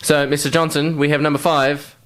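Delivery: ordinary talk, nothing marked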